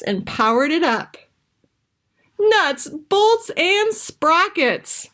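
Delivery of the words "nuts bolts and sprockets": high, slow frustrated outburst; exasperated exclamation. Acted. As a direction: cry out at the failure. Maggie's invention fails again.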